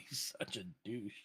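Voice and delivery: ordinary talk, nothing marked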